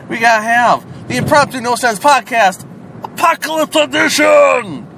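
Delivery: high, loud title announcement; announcer hype. Acted. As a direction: shout the show title grandly. Announces special edition title.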